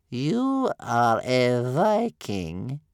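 very slow, loud grandmother voice; suspicious old grandmother. Acted. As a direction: draw out every word with distrust.

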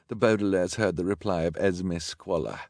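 plain reading voice

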